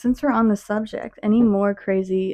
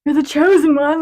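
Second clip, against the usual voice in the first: high, loud teasing announcement; mock proclamation; 7 semitones higher, 8 dB louder than usual. Friend teasing the dreamer, mock proclamation. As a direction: proclaim it grandly, breaking into a laugh.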